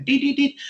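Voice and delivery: high, even little checkout blips; sing-song electronic beeping; imitated scanner beeps. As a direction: mimic a till scanning items.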